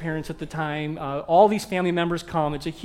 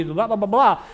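normal talking voice

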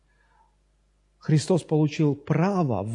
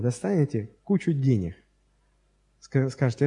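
ordinary talk

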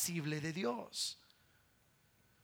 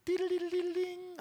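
bell imitation; 10 semitones higher than usual. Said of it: "con solo el".

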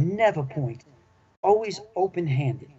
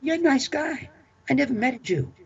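put-on Southern accent; 10 semitones higher than usual. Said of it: "he says in a Southern accent".

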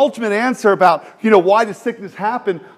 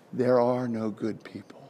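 quiet stark; 6 semitones lower, 10 dB quieter than usual. Real, not acted. Stark sermon point.

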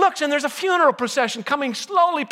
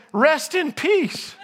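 solemn reading; 5 semitones higher, much slower than usual. Acted. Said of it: "and it says".

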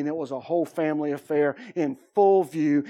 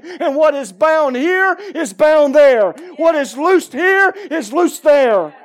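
rising preaching; 5 semitones higher, 7 dB louder than usual. Retold or imitated. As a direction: preach it over him, climbing to a roar. Preaching to the family in the lobby.

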